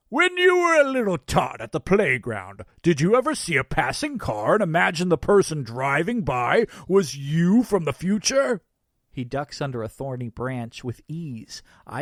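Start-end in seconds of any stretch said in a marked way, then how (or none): 0.1-8.6 loud, raised Santa voice; shouting over shoulder. A narrator's reading. Santa running ahead of his pursuer, shouting back. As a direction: call the question back while running off. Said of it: "shouting over his shoulder".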